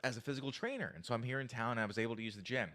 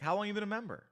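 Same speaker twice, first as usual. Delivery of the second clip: lifted, higher-pitched friendly question; smiling tonality; 7 semitones higher, much faster than usual. Shown as a demonstration. Approaching a woman at the gym, smiling tonality. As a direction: ask it with an audible smile, pitched up.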